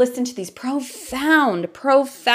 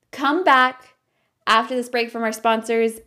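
ordinary talk, nothing marked

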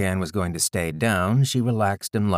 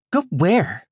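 put-on scared girl voice; 10 semitones higher, 5 dB louder than usual. Scared daughter.

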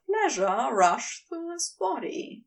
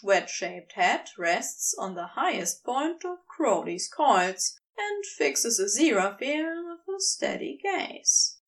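ordinary delivery, nothing marked

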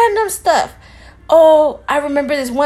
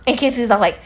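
ordinary talk

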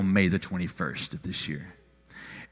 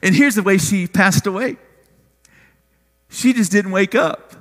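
raised, high-pitched pulpit delivery; preaching; 11 semitones higher, 4 dB louder than usual. Real, not acted. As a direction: project it to the congregation, pitched up.

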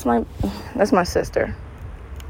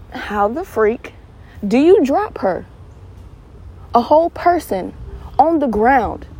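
incredulous outrage; 5 semitones higher than usual. Real, not acted. Outraged rant.

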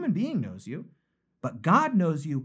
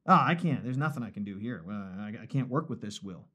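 low, soft, defeated grumble; mock giving up; 9 semitones lower, 5 dB quieter than usual. Preacher imitates God rejecting a broken will, mock defeat. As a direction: sink down into hammed-up resignation. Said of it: "He's not like".

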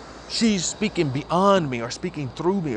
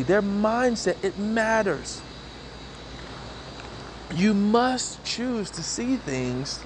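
slow, higher earnest sermon delivery; preaching; 5 semitones higher, slower than usual. Acted. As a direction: stretch it out for the congregation. Preaching to viewers.

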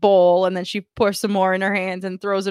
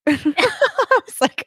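laughing; 11 semitones higher, much slower than usual. Real, not acted. Laughing at vet's advice.

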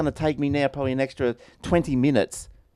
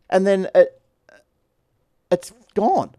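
exasperated; 6 semitones higher, 7 dB louder than usual. Real, not acted. Frustrated about lost recording.

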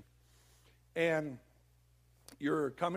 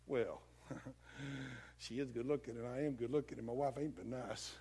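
low, soft, wavering reasoning; tempted husband; 7 semitones lower, 8 dB quieter than usual. Acted. Husband talking himself into flirting, low and sheepish. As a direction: give in quietly, pitched down. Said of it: "He says, "Well, how about flirting?".

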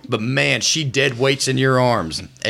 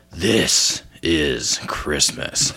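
slow, lower, drawn-out threat; menacing old lady; 8 semitones lower, much slower than usual. Acted. Grandma throwing the yell back, slow and lower.

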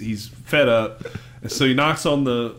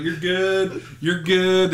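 lightly imitated, higher-pitched all-clear; SpongeBob; 5 semitones higher, much slower than usual. SpongeBob looking over the side for Patrick, lightly voiced reassurance. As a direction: guide the driver in, voice a bit raised. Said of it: "SpongeBob's looking over the side".

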